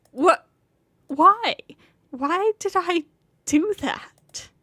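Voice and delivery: loud, high disbelief; shocked. Acted. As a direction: cry it out, stunned. Character shocked at own act.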